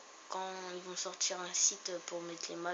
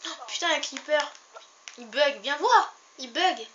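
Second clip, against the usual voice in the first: excited yelling; 7 semitones higher, 12 dB louder than usual. Real, not acted. Creeper appears in game.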